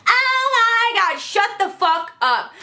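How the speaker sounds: high, drawn-out exasperated yell; pissed-off yelling. Retold or imitated. Pissed student yelling back at her teacher. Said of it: "a bitch is yelling because I'm fucking pissed".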